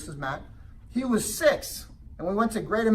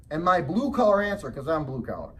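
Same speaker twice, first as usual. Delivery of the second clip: ordinary talk, nothing marked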